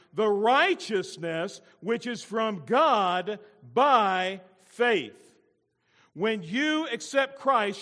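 loud, high, slow proclamation; slow, emphatic, ringing preacher's voice; full-force preaching. Real, not acted. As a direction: stretch out the key words with pulpit power.

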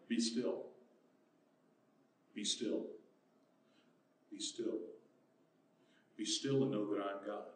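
low, soft, with long pauses; hushed command. Acted. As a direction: quietly settle them down as God. God's quiet command to congregation.

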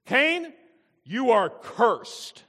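high, loud, drawn-out pronouncement; passion and authority. Shown as a demonstration. God pronounces the curse on Cain, passion and authority. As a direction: thunder the curse with full authority. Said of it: "with passion and authority".

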